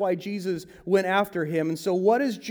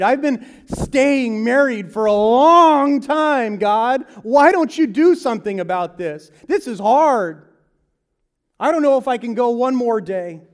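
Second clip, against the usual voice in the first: desperate prayer; 4 semitones higher, 7 dB louder than usual. Retold or imitated. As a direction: plead with God like you're at the end of your rope. Prayer of a struggling believer.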